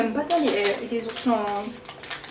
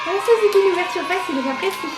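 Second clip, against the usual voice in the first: excited; 4 semitones higher than usual. Real, not acted. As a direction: burst out delighted. Pleased by easy-open packet.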